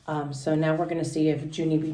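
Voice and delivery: ordinary delivery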